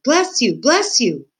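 high exclamation, easing on the repeat; shouted. A narrator's reading. Meg answering Gregory's repeated sneezes, shouted. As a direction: call it out twice in quick succession. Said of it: "shouted Meg".

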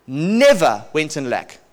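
slow, weighted repeat; slowed-down testimony emphasis; slow emphatic repeat. Real, not acted. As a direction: let each word land slowly.

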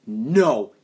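loud acted cry of refusal; shout. Looking up at friend too late, acted shout. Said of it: "and I shout".

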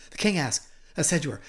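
usual speaking voice

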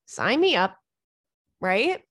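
ordinary talk

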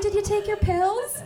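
high, pushy mom check-in; mom asking. Retold or imitated. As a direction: play mom nagging about the pills. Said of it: "She's like".

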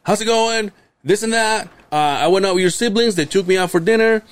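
high, fast imitation of dad's phone rundown; imitating dad. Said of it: "he does this thing when he says".

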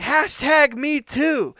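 high, loud and drawn out; re-enacted heckling yell. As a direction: holler it slowly across a venue. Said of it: "I, like, yelled".

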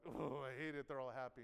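low, grumbled imitation of resentment; mock resentment. Preacher voices an angry churchgoer watching the happy ones, mock resentment. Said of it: "And you're like".

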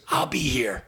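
hushed whisper. Retold or imitated. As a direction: whisper it slyly. Vic hiding backstage.

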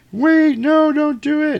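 put-on very high, loud frantic child; mock little girl plea. Little girl running up begging them to stop, high frantic child voice. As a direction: cry out like a scared little girl.

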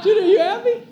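very high, loud, delighted question; excited asking. Retold or imitated. Uncle answers the little boy, excited asking. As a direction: squeal the question at a little kid. Excited reply to toddler, retold.

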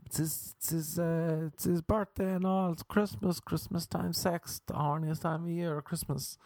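high, fretful pleading; mock pious excuse. Ashamed lover invoking Jesus's birthday, high mock-pious excuse. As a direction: justify it in a high put-on voice.

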